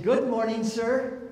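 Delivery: put-on a chirpy young clerk's good morning; cheerful counter clerk. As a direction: greet him like a sunny rental clerk. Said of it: "young woman have a nice attitude?".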